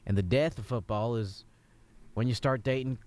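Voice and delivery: mild Southern coach voice, easygoing; a Southern football coach giving a rousing talk; Southern accent. Acted. Coach telling the kid about walkout songs, in a mild Southern accent. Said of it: "let's just both be Southern".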